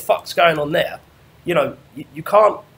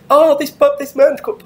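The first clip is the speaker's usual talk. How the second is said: high, loud hysterical mimicry; semi-hysterical rant; 13 semitones higher, 8 dB louder than usual. Imitating the caller's frantic rant about another man. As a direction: ham up a shrill, frantic caller. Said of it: "a bit semi-hysterical homo rant".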